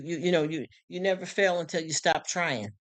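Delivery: ordinary talk, nothing marked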